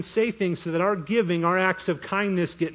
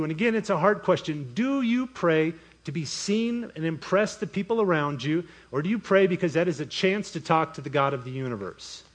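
usual speaking voice

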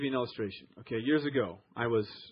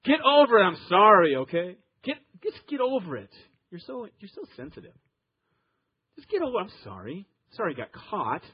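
dismissive fake apology; 3 semitones higher, 7 dB louder than usual. Shown as a demonstration. Husband brushing off his wife with a fake sorry, impatient. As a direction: snap it like you're done hearing it. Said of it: "basically you're saying".

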